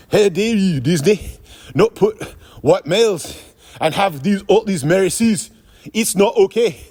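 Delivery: put-on outraged Irish accent slipping towards South African; mock Irish accent. A podcaster mocking a movie critic's outrage, bad Irish accent. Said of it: "gonna do my best Irish accent".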